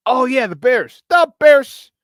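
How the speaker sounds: excited shout. Real, not acted. Remembers the Bears and builds up excitement.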